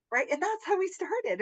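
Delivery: normal talking voice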